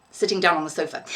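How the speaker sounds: usual speaking voice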